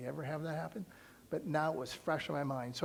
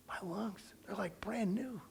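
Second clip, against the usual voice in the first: hushed wonder; 5 semitones higher than usual. Retold or imitated. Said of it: "with a perfect voice".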